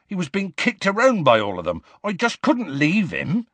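high-pitched defensive plea; upset pleading. A narrator's reading. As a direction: plead urgently for a brother. Hagrid pleading for his brother.

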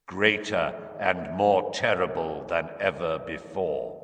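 slow, ominous remembered prophecy; Trelawney, deep prophecy. A narrator's reading. Harry recalls the prediction, slow deep trance voice. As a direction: intone it slowly, like an oracle in trance. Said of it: "her voice went all deep".

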